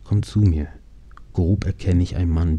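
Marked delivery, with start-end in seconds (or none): none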